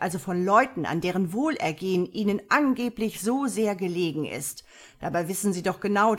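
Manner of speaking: loud, indignant tirade; acted furious accusation; talking himself into rage. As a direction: berate him, outraged, with hammered emphasis. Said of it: "sich Muswessels in Rage redete".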